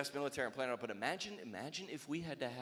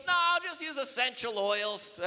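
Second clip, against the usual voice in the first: whiny soldier; 12 semitones higher, 9 dB louder than usual. Acted. Recruit answers general.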